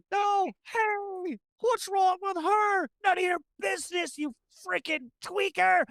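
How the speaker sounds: high-pitched acted squabble; indignant confrontation. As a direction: snap back at a nosy stranger. Background voices arguing with the runner.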